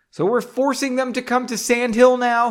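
annoyed. A narrator's reading. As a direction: push back at her, irritated. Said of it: "becoming annoyed with her quickly".

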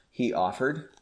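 ordinary delivery, nothing marked

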